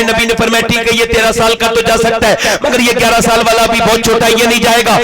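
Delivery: steady, projected preaching on a level pitch; insistent near-monotone sermon narration; impassioned sermon storytelling. Real, not acted. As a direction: tell the story to a big crowd, driving on phrase by phrase.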